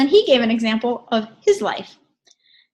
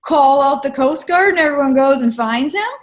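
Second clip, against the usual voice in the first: mock exasperated dad; 4 semitones higher, 4 dB louder than usual. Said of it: "He goes".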